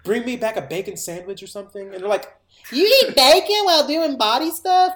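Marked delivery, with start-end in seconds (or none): 0.0-1.9 a lightly acted, higher-pitched plea; mock pleading request. Morgue guy asks coworkers for food, mock pleading. 2.7-4.9 high, loud, incredulous squawk; mock disbelief. Coworkers react to morgue guy's snack, mock disbelief. Acting coworkers' shocked reply.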